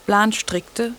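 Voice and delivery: plain reading voice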